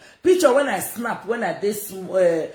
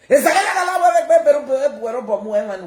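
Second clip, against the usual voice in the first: high, loud, laughing gibberish; laughing mock Spanish; 5 semitones higher, 6 dB louder, faster than usual. Streamer mocking her rivals in fake Spanish, laughing. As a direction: giggle through fake Spanish.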